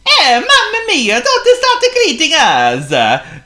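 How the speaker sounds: high, loud wailing Italian plumber; Italian accent. A narrator's reading. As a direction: wail it in a thick Italian accent. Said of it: "wailed Bruno".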